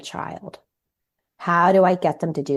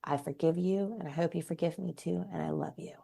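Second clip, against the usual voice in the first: soft, fading farewell words; tender; 11 dB quieter than usual. Retold or imitated. To her dying mother in hospital, soft and tender. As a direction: say it gently, almost to yourself, growing softer. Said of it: "I went to her in the hospital and I said".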